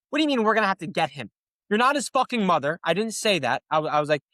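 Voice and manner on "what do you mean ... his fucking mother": fast, higher, mocking disbelief; imagined retort. Defendant imagining telling off the prosecutor, fast and high. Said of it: "imagined retort to prosecutor".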